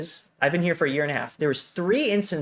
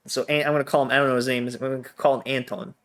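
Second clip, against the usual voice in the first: ordinary talk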